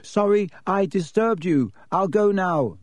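half shout. Acted. Harry calling out to the wizard who ignores him, half shouting. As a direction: lift the voice without yelling. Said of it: "he half shouted".

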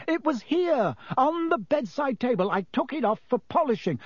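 high, frantic shouting; panicked shout about a lost badge. A narrator's reading. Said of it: "Percy was shouting".

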